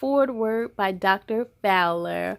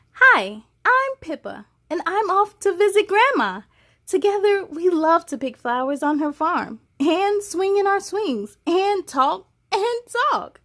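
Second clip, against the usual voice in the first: put-on higher, bright little girl voice; little girl narrator, raised pitch; young girl Pippa; 5 semitones higher than usual. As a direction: read as a cheerful child.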